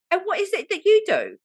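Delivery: high-pitched mock British woman; imitated English woman's sing-song question; British accent. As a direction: ask it in a put-on, high British voice. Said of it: "not allowed to do British accent".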